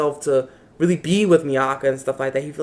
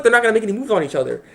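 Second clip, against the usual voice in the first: ordinary delivery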